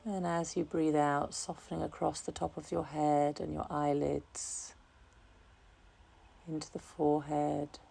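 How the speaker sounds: calm, unhurried meditation voice, softening gradually; guided meditation. Real, not acted. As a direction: guide listeners gently into relaxation, leaving long pauses. Leads a relaxation meditation.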